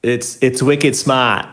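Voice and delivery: New England "wicked smart", r dropped, slow and drawn; New England accent. Shown as a demonstration. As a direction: say "wicked smart" like a Boston local. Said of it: "that's the South African accent with the New England accent".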